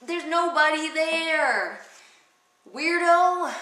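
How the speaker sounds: put-on high, scornful modern girl voice; mocking Lady Macbeth. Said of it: "She's like".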